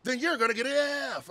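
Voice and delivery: lightly mimicking the animated teacher; imitating the teacher. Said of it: "I like how animated that Mister Gerson is".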